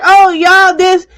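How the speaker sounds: high, loud imitation of her complaining; mock dramatic whine. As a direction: mimic her loudly, pitched right up. Mimicking another woman's rant.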